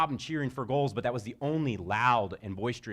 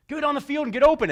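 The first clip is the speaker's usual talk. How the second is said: high, loud coach yell across the field; coach shouting; 10 dB louder than usual. Retold or imitated. Coach yelling at little girls to get open. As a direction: bark the instruction to the kids.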